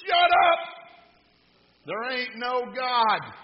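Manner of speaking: exasperated acted shout, stretched out; angry yell. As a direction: yell back like a fed-up neighbor. Fed-up atheist neighbor yells back.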